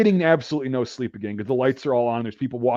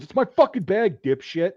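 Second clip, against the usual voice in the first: raised-pitch angry outburst, lightly acted; angry retort; 6 semitones higher than usual. Guy hits recruit over grabbed bag, mock angry. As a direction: imitate an irritated guy claiming his property.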